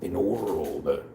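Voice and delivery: low, slow, soft trailer narrator; hushed, drawn-out trailer-announcer voice; movie trailer voice. Acted. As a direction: intone it slowly like a movie trailer. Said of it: "The voice again?".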